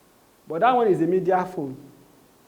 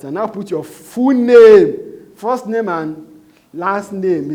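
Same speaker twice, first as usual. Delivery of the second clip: much louder, slow, drawn-out command; emphatic instruction; 4 semitones higher, 12 dB louder, much slower than usual. Shown as a demonstration. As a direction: hammer home the instruction slowly and loudly. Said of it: "teacher stresses key instruction".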